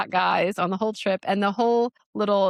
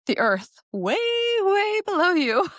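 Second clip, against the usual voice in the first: stretched, rising high on the second way; slow, high, awed emphasis on way, way below; dramatic way way below; 12 semitones higher, 4 dB louder than usual. Real, not acted. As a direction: draw out the drop, climbing in pitch. Dramatizing the height at Blarney.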